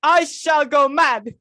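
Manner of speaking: slow, high, loud cry of despair; going mad. Acted. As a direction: wail it out at the end of her rope. Hostess despairing over dinner seating.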